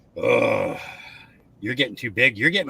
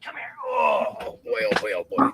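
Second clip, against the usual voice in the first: cooing, soft pet-talk voice, often much softer; 4 semitones lower, 4 dB quieter than usual. Real, not acted. Owner coos to her puppy on stream, soft baby talk.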